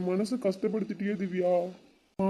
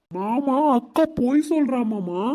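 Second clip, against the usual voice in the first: higher, louder, slower plea to be believed; urgent pleading reveal; 4 semitones higher, 3 dB louder, slower than usual. Acted. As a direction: press him hard with the news. Urgent secret revealed to uncle.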